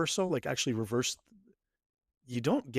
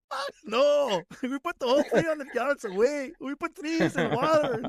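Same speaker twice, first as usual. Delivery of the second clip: put-on Scottish accent; 12 semitones higher, slower than usual. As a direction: shout it in a broad Scottish accent. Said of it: "He puts on a Scottish accent".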